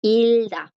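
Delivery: "Ilda" stretched, G left out; silent G. Shown as a demonstration. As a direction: model "Ilda" slowly with a long "il". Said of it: "la G no suena".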